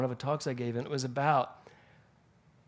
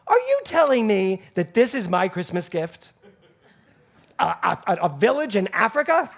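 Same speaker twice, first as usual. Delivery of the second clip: louder, laughing mock outrage; hammed-up offended diva, half laughing; indignant laughing disbelief; 5 semitones higher, 9 dB louder than usual. Dolores Gray scolding her castmate over the gift, mock outraged. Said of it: "She goes".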